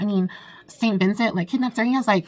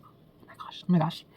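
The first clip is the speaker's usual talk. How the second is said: voiceless shocked whisper; 3 semitones lower, 6 dB quieter than usual. Real, not acted. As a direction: breathe it out, scandalised. Shocked by his thoughts.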